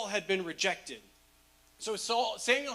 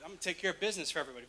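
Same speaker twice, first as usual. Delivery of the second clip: rushed swagger; 6 semitones lower, 5 dB quieter, much faster than usual. Retold or imitated. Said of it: "with his chest like".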